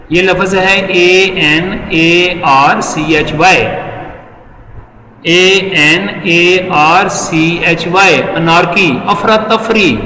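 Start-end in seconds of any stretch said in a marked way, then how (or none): 1.0-3.6 "اے این اے آر سی ایچ Y" said letter by letter with a pause after each; slow spelling. Shown as a demonstration. Teacher corrects a spelling mistake by spelling the letters out slowly. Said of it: "یہ spelling میں mistake ہو گئی ہے". 5.2-8.2 "اے این اے آر سی ایچ Y" said letter by letter with a pause after each; slow spelling. Shown as a demonstration. Teacher corrects a spelling mistake by spelling the letters out slowly. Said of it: "یہ spelling میں mistake ہو گئی ہے".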